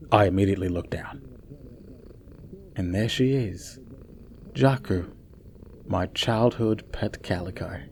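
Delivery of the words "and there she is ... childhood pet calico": soft, hushed awe at the lost pet; hushed wonder. A narrator's reading. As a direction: breathe it out in quiet wonder. Sees long-lost childhood cat.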